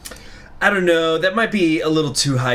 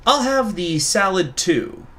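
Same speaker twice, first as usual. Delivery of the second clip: higher, slow character voice; 8 semitones higher, slower than usual. Acted. Character orders.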